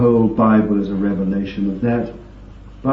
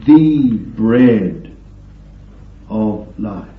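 the bread of life leaned on, louder and firm; emphatic stress; 3 dB louder, much slower than usual. Shown as a demonstration. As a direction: press hard on "the bread" as the one true bread. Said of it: "And it's the emphatic".

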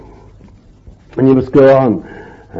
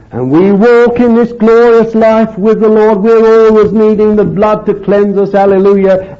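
higher, louder fervent preaching; full-force preaching; 8 semitones higher, 3 dB louder than usual. Acted. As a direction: lift it up and proclaim to the congregation.

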